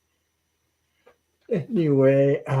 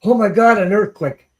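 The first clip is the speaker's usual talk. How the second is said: high, loud alarmed exclamation; startled cry; 7 semitones higher, 9 dB louder than usual. Retold or imitated. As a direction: jolt awake in panic, louder and higher. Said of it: "and I said".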